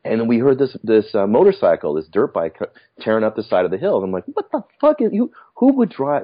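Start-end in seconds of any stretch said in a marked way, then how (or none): 4.3-6.2 much higher, startled exclamation; retold disbelief. Watching a dirt bike tear up the hill, startled disbelief. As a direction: react to a bike tearing up the hill.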